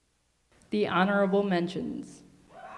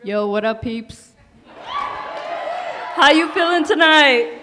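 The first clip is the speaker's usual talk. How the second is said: loud, high crowd-pumping shout; hype host; 7 semitones higher, 10 dB louder than usual. Real, not acted. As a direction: get the crowd going. Judge hypes up the crowd.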